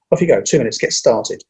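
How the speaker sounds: ordinary talk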